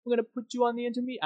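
high, fast mock Italian accent; bad Italian accent. AlphaTauri telling the driver about intermediates, in a fast mock Italian accent. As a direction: rattle it off in a goofy fake Italian accent. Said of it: "a really poor Italian impersonation".

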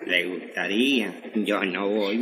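low, soft grumble under the breath; muttered aside. Acted. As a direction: mutter it so mother can't hear. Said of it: "¿Cómo dijiste, mijo? A ver, repetime". (murmurando entre dientes)

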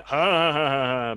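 hammed-up villain "ha ha ha"; pantomime villain laugh. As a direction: imitate a pantomime baddie's entrance laugh. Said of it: "you stand on the flies and you do".

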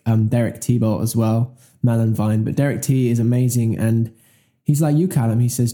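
normal talking voice

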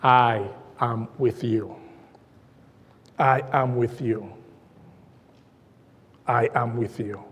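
softly voiced Jesus' promise; tender promise. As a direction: say it like a gentle assurance.

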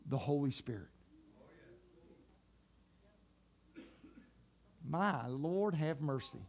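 hushed tender Jesus; soft reverent telling. Acted. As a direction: tell it gently, full of awe.

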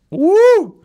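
high, loud gleeful whoop; shrieked catchphrase cheer, very high and loud. Acted. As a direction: whoop it up like a hype man.

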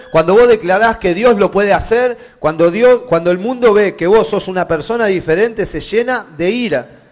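loud, driving sermon delivery; forceful preaching. Acted. Preacher warns congregation about the world's anger, full force. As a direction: build into a loud, insistent proclamation.

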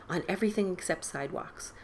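plain reading voice

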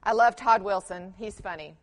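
normal talking voice

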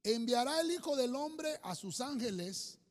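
loud, slow, solemn proclamation; booming sermon reading of the verse; scripture reading. Real, not acted. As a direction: read the verse out like a pulpit declaration. Preacher reads scripture aloud.

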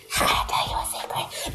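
very soft, secretive whispering; mock whisper. Intrusive guest telling the server a secret, mock whisper. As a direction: imitate someone whispering right into an ear. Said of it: "whispering all in my face saying".

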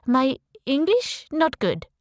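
put-on slow, loud broken English in a French accent; fake French accent. Convincing the officer she is French, fake French accent. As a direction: play the helpless French tourist. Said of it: "in my best French accent".

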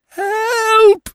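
a victim's drawn-out scream for help; terrified cry. A narrator's reading. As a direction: cry out in terror from a distant room. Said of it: "a long, thin, drawn cry".

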